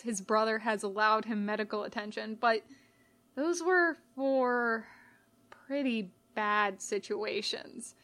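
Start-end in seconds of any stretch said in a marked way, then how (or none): none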